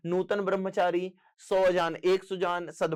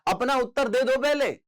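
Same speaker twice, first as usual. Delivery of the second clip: high, raised teacherly insistence; insistent urging; 7 semitones higher, 4 dB louder than usual. Real, not acted. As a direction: press the students, pitch jumping up. Teacher urging students to answer first. (ऊँचे स्वर में आग्रह)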